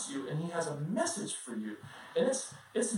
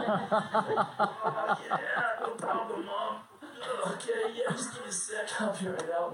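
put-on sleepy kid voice; 3 semitones higher, slower than usual. Kid faking compliance.

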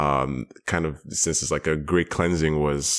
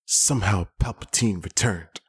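lightly voiced Poe Dameron, a touch of weary gravity; a hint of Oscar Isaac delivering bad news; mild Poe Dameron line, half-hearted; Oscar Isaac impression; 3 semitones higher than usual. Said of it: "That's my best Oscar Isaac".

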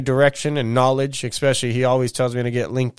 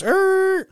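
high squealing tire noise; imitated tire screech. Mimicking tires while braking hard. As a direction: squeal it like brakes locking.

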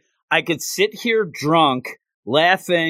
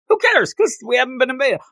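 mock careless soldier; 9 semitones higher, faster than usual.

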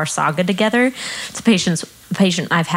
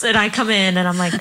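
normal talking voice

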